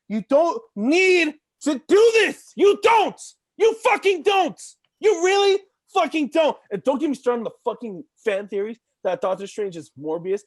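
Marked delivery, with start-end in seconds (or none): 0.1-6.6 high, loud escalating shout; frustrated fan yelling at the studio; ranting shout. Real, not acted. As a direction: hammer each "don't" harder. Rant peaks in shouting.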